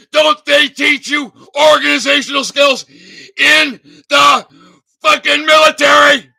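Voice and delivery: real fury cracking at the close; furious shout. Real, not acted. Berating veterans for giving up, furious shout. Scolding veterans about the military.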